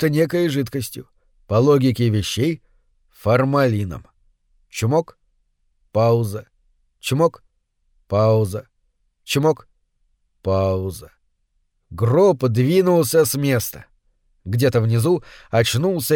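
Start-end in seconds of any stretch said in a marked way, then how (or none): 4.7-11.1 чмок up, пауза dropped low; rhythmic pump. A narrator's reading. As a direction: mechanical beat: чмок rising, пауза falling deep. Ритмичный звук помпы. (ритмично: «чмок» вверх, «пауза» низко вниз)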